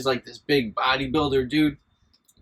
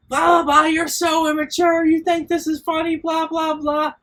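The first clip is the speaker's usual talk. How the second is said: much higher mocking teacher voice; mock yelling teacher; 13 semitones higher than usual. Mocking the math teacher yelling at him, much higher voice. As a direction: parody a shrill scolding teacher. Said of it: "she starts fucking yelling at me".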